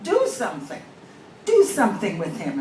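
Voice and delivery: loud, high insistent demand. Acted. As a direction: order them to act, loudly. Woman demands Englishmen act.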